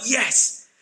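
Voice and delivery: eager disciples. Retold or imitated. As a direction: gush like keen disciples who finally get it. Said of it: "they're like".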